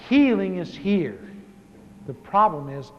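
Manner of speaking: slow, emphatic preaching. Acted. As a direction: preach it slowly, each phrase weighed. Preaching at full force.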